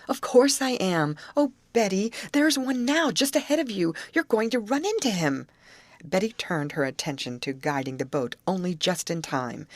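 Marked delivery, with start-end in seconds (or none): 0.1-5.5 high, urgent and frightened; alarmed warning. A narrator's reading. Grace warning Betty at the tiller, alarmed. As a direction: warn her fast before the boat hits it.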